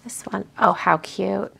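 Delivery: ordinary delivery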